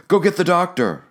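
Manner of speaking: put-on young mother begging help for her stung baby; Juana, urgent. A narrator's reading. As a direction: raise the pitch, a mother's urgent plea. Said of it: "she said".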